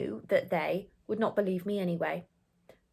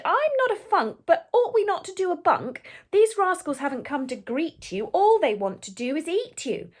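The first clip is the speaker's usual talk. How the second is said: higher, worried plea; nervous boy; 7 semitones higher, 4 dB louder than usual. Acted. The boy urges the toad to flee, pitched up and anxious. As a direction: urge a quick escape, uneasy. Boy voices his fear.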